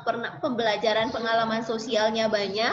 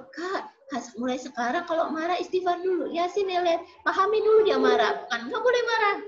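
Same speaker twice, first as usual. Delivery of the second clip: put-on higher, patient mother talking a child through anger; gently steer the kid, in a raised motherly voice; a mother teaching her child to calm down, voiced higher; coaching mother; 7 semitones higher than usual. Parent guiding angry child.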